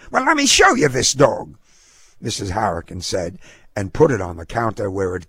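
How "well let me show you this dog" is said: high-pitched indignant woman; feisty dog owner, protesting. A narrator's reading. Dog owner disputes the fee at the counter, indignant. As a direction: argue back in a high, feisty voice.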